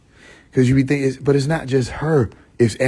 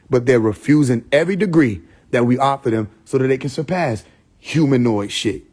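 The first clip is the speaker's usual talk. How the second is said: ordinary talk, nothing marked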